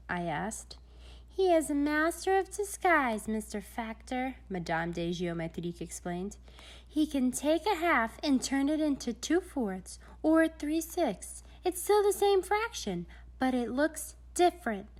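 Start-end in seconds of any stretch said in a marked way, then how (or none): 1.3-4.3 lightly raised voice for Madame; a hint of a grand lady explaining; Madame de Géométrique. A narrator's reading. Narrator gives Madame a higher voice to Mister Factor. 6.9-14.9 higher, lightly voiced lady explaining; a hint of a grand lady lecturing; Madame de Géométrique. A narrator's reading. Madame explains disguises to Mister Factor, higher voice.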